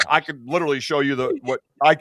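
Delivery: normal talking voice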